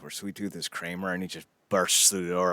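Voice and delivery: normal talking voice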